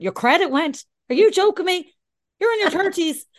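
loud, high-pitched disbelief; incredulous telling-off. Retold or imitated. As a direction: scold a friend in mock outrage. Retold telling-off of broke friend.

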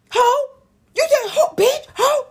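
high, loud, mocking insult; shrill-free high mock shout at a rival; mock loud yell. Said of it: "all loud and".

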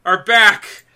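loud, high, emphatic celebration; real fan elation; ecstatic announcement. Real, not acted. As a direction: proclaim the reunion like an overjoyed fan. Celebrates favorite tag team reunion.

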